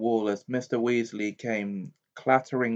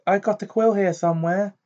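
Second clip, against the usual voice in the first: higher, louder flustered voice; anxious mother; 9 semitones higher, 5 dB louder, faster than usual. A narrator's reading. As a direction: fluster while rummaging. Retake of same line.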